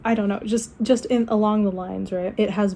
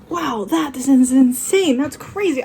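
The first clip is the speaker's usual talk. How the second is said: mildly hammed-up astonishment, higher pitch; acted amazement; 4 semitones higher, 5 dB louder than usual. Woman retelling her reaction to a video, acted amazement. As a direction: replay your own stunned reaction.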